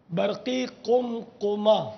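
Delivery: برقی قم قمہ drawn out slowly, each part spelled out; slow, deliberate term. Shown as a demonstration. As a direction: stretch برقی قم قمہ syllable by syllable, falling on برقی. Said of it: "ہیں دو تین کو؟".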